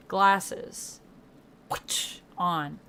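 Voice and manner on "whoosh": breathy whispered whoosh; whoosh sound effect. Pretend. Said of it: "Make my own sound effects".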